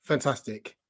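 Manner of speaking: ordinary talk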